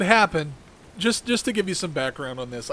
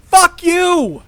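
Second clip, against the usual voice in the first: furious yell, much higher and louder; angry shout; 12 dB louder than usual. Real, not acted. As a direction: explode at the critics. Angry rant against critics.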